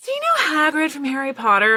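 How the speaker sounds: high, put-on asking voice; imitating a blithe, high-voiced director; mock casual request. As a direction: pitch it way up as the director. Director springing an accent request.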